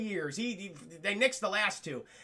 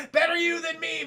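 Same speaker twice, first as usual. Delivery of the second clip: loud laughing gloat on Better you than me; laughing shout; 7 semitones higher, 5 dB louder than usual. Acted. Chad gloating to himself, laughing shout.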